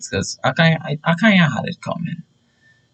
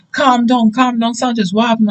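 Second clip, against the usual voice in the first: high-pitched teacher trying to restrain a student; imitated alarmed teacher; urgent teacher; 8 semitones higher than usual. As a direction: plead for calm, high and quick. Voices teacher restraining him.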